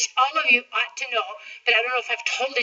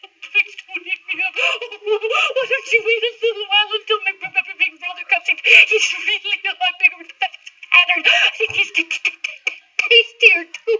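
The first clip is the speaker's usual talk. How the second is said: stammering giggly goat; 6 semitones higher than usual. Acted. Second goat begs, stammering.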